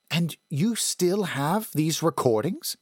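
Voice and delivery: a hint of an older woman, questioning; woman's voice. A narrator's reading. Woman character speaks.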